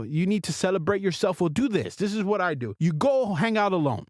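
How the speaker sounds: normal talking voice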